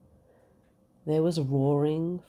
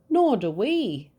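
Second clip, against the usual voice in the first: higher, louder united reply; chorus; 7 semitones higher, 6 dB louder than usual. Acted. Knights answering the dragon in chorus. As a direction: call it out together as a group. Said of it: "chorused the knights".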